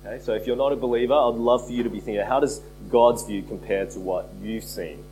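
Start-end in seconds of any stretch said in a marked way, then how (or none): none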